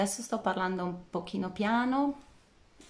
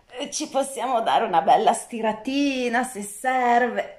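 stretching; 5 semitones higher, 11 dB louder than usual. Real, not acted. Invites a good stretch.

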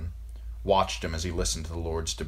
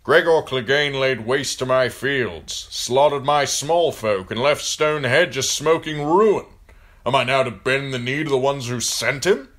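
loud, high, indignant protest; aggrieved river lord, voice raised; outraged lord; 8 semitones higher, 9 dB louder than usual. Acted. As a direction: rail against the wrong done to you. Lord Bracken's angry complaint in story.

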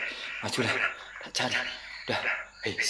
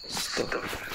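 startled whisper; 7 dB quieter than usual. Real, not acted.

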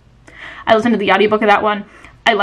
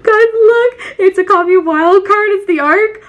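teary, overjoyed; 10 semitones higher, 3 dB louder than usual. Real, not acted. Crying with joy over book.

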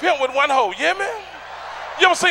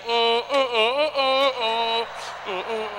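humming; 6 dB quieter, much slower than usual. Retold or imitated. Eater's humming while eating.

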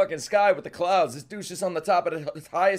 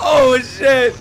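shouted oh shit; 5 semitones higher, 9 dB louder than usual. Real, not acted. Hype reaction, shouted.

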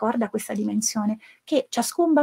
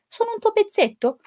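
high, small questioning voice; small character voice; 12 semitones higher than usual. Acted. Pezzettino asks the mountain dweller, high small voice. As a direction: pitch it up like a tiny piece asking.

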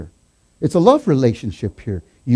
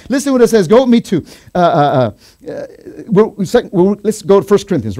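high, loud, stumbling eagerness; worked-up preacher rushing to the next verse; real excitement, tripping over words; all excited; 4 semitones higher, 7 dB louder than usual. Real, not acted. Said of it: "I'm getting all excited up here".

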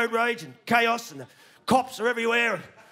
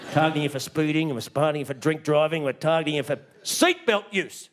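lower, menacing official announcement; a hint of a menacing official, lower; ominous sign voice; 8 semitones lower than usual. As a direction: intone it like a threatening government sign. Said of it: "There you go".

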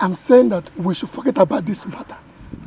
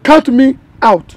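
loud, slow, separated "count me out"; emphatic, drawn-out "count me out"; firm refusal; 3 semitones higher, 9 dB louder, slower than usual. Acted. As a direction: punch each word of "count me out". Firmly refuses, repeating himself.

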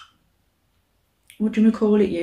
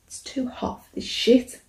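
breathy complaint; 5 semitones higher, 5 dB quieter than usual. Real, not acted. Complaining about heat.

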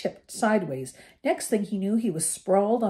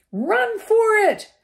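much higher, louder, slow shout; 7 dB louder, slower than usual. A narrator's reading. Ron shouts to Harry to flee the car. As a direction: yell at your friend to get out. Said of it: "Ron shouted".

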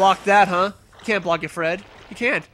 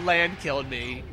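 normal talking voice

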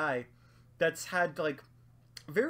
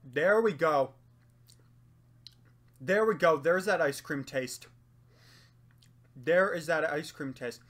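eating between words; talking while chewing; 2 semitones lower, 4 dB louder than usual. Real, not acted. Seen 0: Holds cookie by mouth; closed-mouth chewing between phrases, milk glass nearby.

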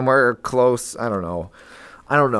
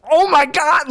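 high, loud astonished exclamation; acted open-mouthed disbelief; retold amazement; 5 dB louder, slower than usual. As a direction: gasp it out as if awestruck, hammed up. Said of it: "my mouth was just wide open".

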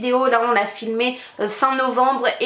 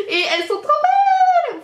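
gushing squeal; 4 dB louder than usual. Real, not acted. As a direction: squeal with delight, soaring very high. Gushing over the palettes. (cri de joie suraigu)

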